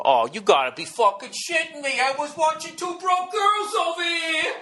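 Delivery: sudden high squealing shout of disbelief; shrill comic outrage at the dark TV; outraged neighbor. Retold or imitated. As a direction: play a guy whose TV just died, shrieking high. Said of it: "they stand there and go".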